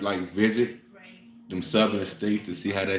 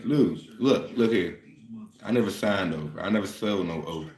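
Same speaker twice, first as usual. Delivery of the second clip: usual speaking voice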